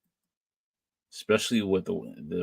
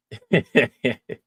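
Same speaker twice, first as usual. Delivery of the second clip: short, louder chuckle; 4 semitones higher, 5 dB louder than usual. Real, not acted. Reacting to a viewer's guest hosting comment, amused chuckle. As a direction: let out a real laugh.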